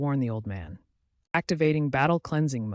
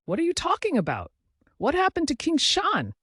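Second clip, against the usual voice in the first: much higher, panicked questioning; alarmed demand; 13 semitones higher than usual. A narrator's reading. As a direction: demand answers in high-pitched alarm. Alarmed at news of friend's death.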